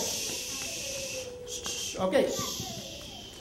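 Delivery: long hissed shh; repeated drawn-out hush; shushing. Real, not acted. Teacher settling the children for the story, long shush.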